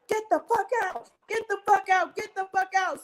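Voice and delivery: high shouted repeat; mock angry yelling at the guest; retold yell. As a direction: yell them out of the house. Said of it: "they're telling her".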